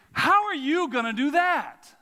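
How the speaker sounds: loud, high incredulous challenge; disbelief. Shown as a demonstration. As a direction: throw the question out as if it's impossible. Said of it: "If you don't believe me".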